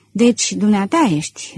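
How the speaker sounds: ordinary delivery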